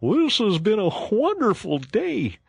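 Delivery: high, loud, thrilled; delighted Mole. A narrator's reading. Mole to Rat on his first boat ride, high and thrilled. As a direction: gush with joy at the boat.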